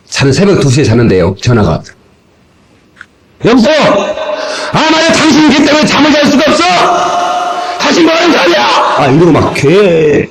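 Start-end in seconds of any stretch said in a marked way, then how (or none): 3.4-8.7 high, hectoring phone rant; acted angry outburst, pitched high throughout; irate caller. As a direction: imitate a furious complaining neighbour. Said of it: "막 이러면서 막 욕하는 거야".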